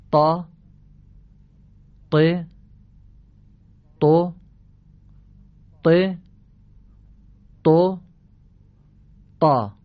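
طا thick from the throat, loud, each syllable alone; heavy throaty ta drill. Shown as a demonstration. Teacher drills listeners to repeat after him: loud heavy طا. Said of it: "خوب گوش بدید و پشت سر من جواب بدید".

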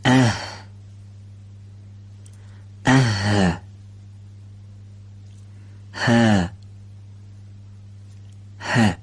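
اه، هی، هو said slowly with breathy h; isolated breathy h syllables, one at a time; h sound drills. Shown as a demonstration.

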